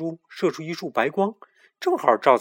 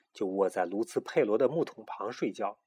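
ordinary delivery, nothing marked

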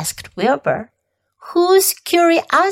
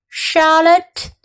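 ordinary delivery

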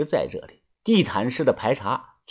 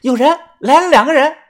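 high, loud shout of alarm; sharp raised call of warning; 13 semitones higher, 7 dB louder, faster than usual. A narrator's reading. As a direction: call out loudly that people are coming. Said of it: "大声地说道".

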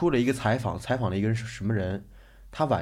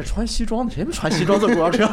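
higher, louder mock comeback; cheeky retort; 9 semitones higher, 4 dB louder than usual. Speaker retorting to the auntie on the bus, cheeky. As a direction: talk back with playful cheek. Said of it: "我说".

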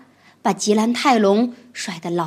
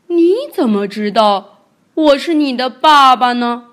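响亮的大恐龙声音，惊讶地问; 吃惊又疑惑的大个子; 霸王龙发问; 2 semitones higher, 9 dB louder than usual. Acted. 霸王龙吓了一大跳. (霸王龙惊讶地大声问)

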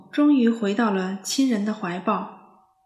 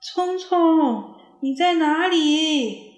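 higher, loud and slow call; anxious calling; 7 semitones higher, 5 dB louder, much slower than usual. A narrator's reading. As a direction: call out into the woods, worried. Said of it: "亲切又焦急的呼唤". (焦急地呼唤)

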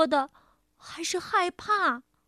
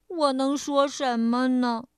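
ordinary delivery, nothing marked

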